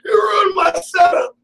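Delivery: slow, high, loud fake-crying wail; badly wounded, play-acted howl; mock tearful tantrum. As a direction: drag it out in a hammed-up sob-shout. Mock tantrum repeated, amid laughter.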